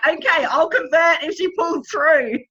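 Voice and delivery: half-joking bargain with God; mock bargain with God. Friend retells bargaining with God, mock-desperate shout.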